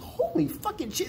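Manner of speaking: high-pitched retold astonishment; amazed. Visitor sees the transformed casino, amazed.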